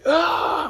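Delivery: sudden high loud yell. Retold or imitated. Startled by the vision, a sudden high yell. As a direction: let out a sharp high shout. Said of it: "When I yelled".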